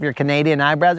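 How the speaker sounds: usual speaking voice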